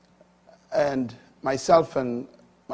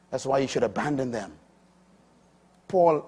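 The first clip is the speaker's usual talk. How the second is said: ordinary delivery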